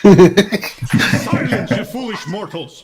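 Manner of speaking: booming villain shout at mortals; dark lord. Acted. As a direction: roar it like an evil overlord. Dark lord cry at players.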